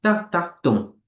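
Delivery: "Da, da, dum" landing on "dum"; two light beats "Da, da", then a firm "dum"; weak, weak, strong. Shown as a demonstration. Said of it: "unstressed, unstressed, and then stressed".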